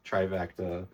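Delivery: ordinary talk, nothing marked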